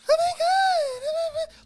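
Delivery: very high, loud squealing gasps; ridiculous squeaky voice. Shown as a demonstration. Showing a ridiculous replacement voice, squealing high. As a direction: squeal like a breathless cartoon girl. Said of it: "replace it with just a ridiculous voice".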